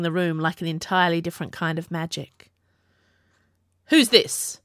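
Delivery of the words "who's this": a sharp, slightly louder challenge; demanding. A narrator's reading. The king demands to know who is in the workroom, sharp. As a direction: demand an answer from a stranger. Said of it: "the king demanded".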